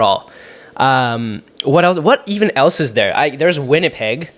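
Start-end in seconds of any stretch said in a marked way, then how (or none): none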